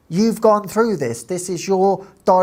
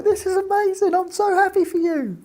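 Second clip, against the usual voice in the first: much higher, overjoyed; delighted gush; 12 semitones higher than usual. Retold or imitated. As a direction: gush with joy for her friend. Retelling her joy at friend's news.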